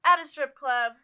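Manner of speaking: affronted reenacted clapback; indignant comeback. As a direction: challenge the scold loudly, reenacting it. Retold confrontation with scolding stranger.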